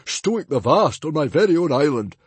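a chief defending his island, loud and furious; threatening chief. A narrator's reading. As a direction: bellow the threat at the intruder. Stoic threatens the librarian.

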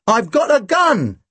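high, slow announcement; exclaiming. A narrator's reading. Partner bluffing the bank guard with a gun, exclaiming. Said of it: "he exclaimed".

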